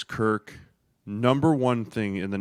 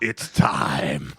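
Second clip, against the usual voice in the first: agent rising like the Undertaker, low and ominous; a low, mock-menacing announcement of doom; 8 semitones lower than usual. Imitating the agent after the Super Bowl, Undertaker style. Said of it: "sat up like the Undertaker".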